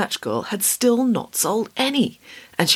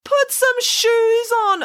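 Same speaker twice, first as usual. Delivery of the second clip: high, drawn-out emphatic order; exasperated urging; 4 dB louder, slower than usual. A narrator's reading. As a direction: urge it insistently, stretching each word. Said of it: "urged Hannah".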